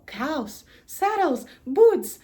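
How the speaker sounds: normal talking voice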